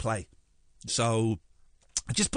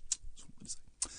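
mock choked up; 15 dB quieter than usual. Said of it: "said I wasn't gonna cry".

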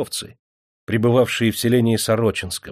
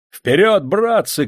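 high, loud battle cry; rallying shout; 10 semitones higher, 6 dB louder, slower than usual. A narrator's reading. Major leads soldiers out to the rescue, shouting. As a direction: shout your men forward. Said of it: "крикнул Елагин".

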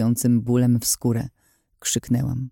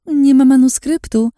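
much higher and louder sing-song mimicry of nie ma; mocking echo; 13 semitones higher, 7 dB louder than usual. A narrator's reading. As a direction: parrot his words back in scorn, stressing nie. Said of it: "Co tam mówiłeś".

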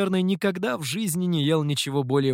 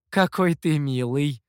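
plain reading voice

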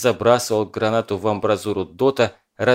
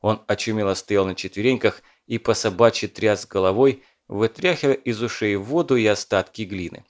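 ordinary delivery, nothing marked

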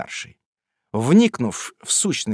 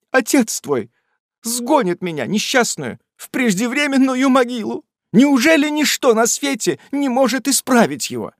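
tearful lament; 11 semitones higher, 4 dB louder than usual. A narrator's reading. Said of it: "залилась горькими слезами".

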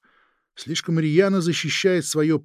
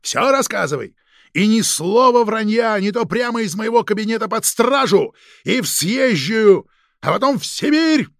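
loud, high, building to a peak; shouted threats; 8 semitones higher, 7 dB louder than usual. Acted. Chief threatens agent with arrest and exile, shouting angrily. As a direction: browbeat him at full voice.

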